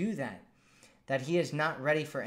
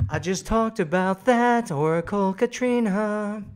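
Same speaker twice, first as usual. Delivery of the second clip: louder, higher playful exasperation; mock exasperated; 6 semitones higher, 9 dB louder than usual. Real, not acted. Host teasing a viewer who repeated her question, loud mock exasperation. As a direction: tease the viewer for asking twice.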